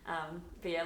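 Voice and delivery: ordinary delivery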